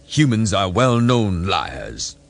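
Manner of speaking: grating. A narrator's reading. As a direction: drag each word out with scorn. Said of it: "his voice grating".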